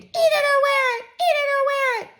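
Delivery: high, loud tantrum shriek; toddler scream. Acted. Toddler shrieking at his father at supper, tantrum scream. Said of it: "Fudge screamed".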